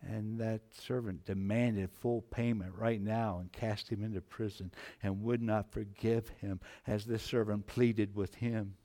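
usual speaking voice

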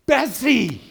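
high, full yell across a crowd; top-of-voice yell. Acted. Farm boy calling his runaway sheep, top-of-voice yell. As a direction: yell it out to call a runaway back. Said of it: "at the top of his voice, he yelled".

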